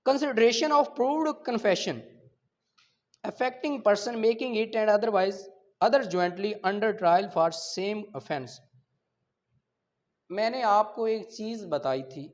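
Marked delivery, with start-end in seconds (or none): 0.1-8.5 slow, loud, deliberate reading of legal text; statute reading. Acted. Lecturer reads the law aloud to his students, slow and emphatic. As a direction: read each clause out slowly, as if dictating.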